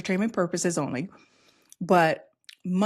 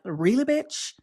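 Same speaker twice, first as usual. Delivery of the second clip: sassy disbelief; 5 semitones higher, slower than usual. Real, not acted. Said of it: "I never talk like this in public".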